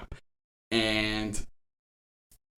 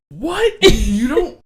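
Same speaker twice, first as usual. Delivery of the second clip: high-pitched mock-incredulous outburst; 6 dB louder, much slower than usual. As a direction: imitate an appalled partner, voice shooting up high. Retold partner's incredulous comeback.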